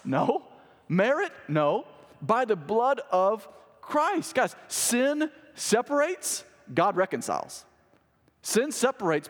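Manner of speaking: high, emphatic pulpit delivery with long stretched key words; ringing sermon climax; fervent preaching. Real, not acted. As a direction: preach the verse point by point, pitch held high. Rereads verse as preaching.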